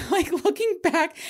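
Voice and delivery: high giggly laugh through the words; giggling while recalling. Real, not acted. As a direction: laugh it out, amused at the memory.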